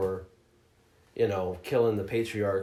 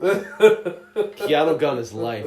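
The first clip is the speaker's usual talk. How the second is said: drawn out, louder, laughing through it; slow hyped proclamation; 3 semitones higher, 7 dB louder, much slower than usual. Real, not acted. Gamer hyping Keanu's gun to his friend, laughing slow proclamation. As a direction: proclaim it like a battle cry, slowly. Repeats hype line bigger.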